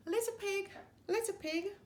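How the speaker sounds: high, sing-song wolf call; sly wolf coaxing at the door; wolf calling sweetly. A narrator's reading. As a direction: coax the pig to open up in a high lilting voice. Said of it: "the wolf said".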